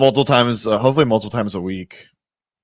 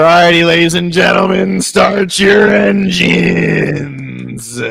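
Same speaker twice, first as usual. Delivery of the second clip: high, loud race caller, stretched out slowly; race announcer; 8 semitones higher, 9 dB louder, much slower than usual. Acted. Streamer kicks off the duck race in a booming announcer call. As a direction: call the start like a stadium announcer.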